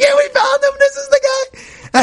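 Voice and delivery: very high, strained excited cry; excited announcing. Retold or imitated. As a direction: announce the catch in a squealing high voice. Catcher excitedly announcing to the yeshiva.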